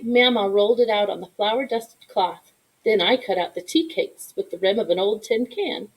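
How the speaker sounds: usual speaking voice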